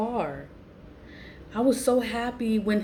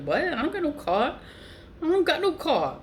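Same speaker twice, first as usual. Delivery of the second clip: much higher, insisting disbelief; shocked denial; 9 semitones higher than usual. Retold or imitated. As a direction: deny it in shock.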